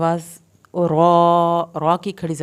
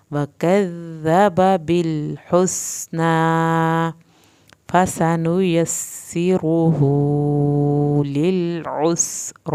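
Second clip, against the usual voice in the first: slow, drawn-out tajweed chant; Quranic recitation; much slower than usual. Shown as a demonstration. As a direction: recite each word slowly, melodically. Recites verse.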